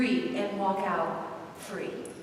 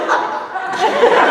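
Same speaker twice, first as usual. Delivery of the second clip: loud laugh; 10 semitones higher, 9 dB louder than usual. Real, not acted. Laughs retelling the priest story.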